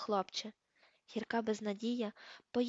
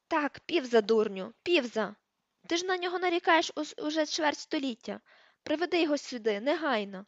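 higher and louder, a stern teacher losing patience; exasperated order; 5 semitones higher, 5 dB louder than usual. Acted. Teacher orders the caretaker to fetch Peeves, exasperated shout.

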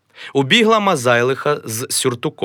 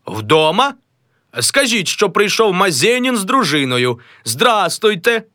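high, polite caller; a high, formal visitor; visiting gentleman; 10 semitones higher, 3 dB louder than usual. Acted. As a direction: announce yourself grandly. Mazailo rehearses visit.

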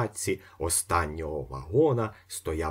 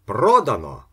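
loud final call; auctioneer's verdict; 4 semitones higher, 10 dB louder than usual. A narrator's reading. Auctioneer closing the sale, loud. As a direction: slam it down like the gavel. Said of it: "сказав аукціоніст".

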